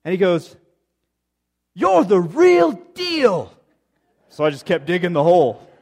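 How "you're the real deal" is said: very slow, high, loud put-on accent; Charles Siegel. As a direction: proclaim each word in a butchered accent. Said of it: "got this incredible accent".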